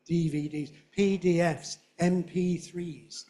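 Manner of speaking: loud, every letter of "P D Fs" fully stressed; great big letters. Shown as a demonstration. Teacher modelling English alphanumerics, great big letters. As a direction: punch each letter and number, "M P threes". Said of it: "great big letters and numbers".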